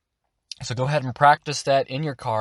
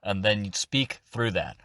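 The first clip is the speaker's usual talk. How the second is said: ordinary delivery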